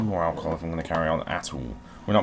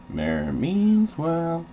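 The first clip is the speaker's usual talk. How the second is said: drawn-out name; 9 semitones higher, 4 dB louder, much slower than usual. Pretend. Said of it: "the name Mayor Meanswell is the funniest".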